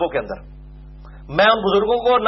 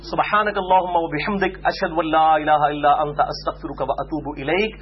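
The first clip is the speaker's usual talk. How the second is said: slow, softer Arabic recitation; closing prayer; 5 semitones lower, 3 dB quieter, slower than usual. Acted. Preacher closes his sermon with a slow prayer. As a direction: recite the closing supplication calmly.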